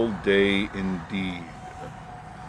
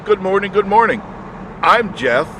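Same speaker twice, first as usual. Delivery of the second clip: cheery show host; 10 semitones higher, 4 dB louder than usual. Acted. Cheery morning show intro.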